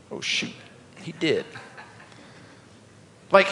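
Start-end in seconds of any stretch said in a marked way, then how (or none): 0.1-1.4 soft, understated aside; casual realization. Acted. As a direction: let it slip out quietly, imitating a casual reaction. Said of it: "just kinda casually like".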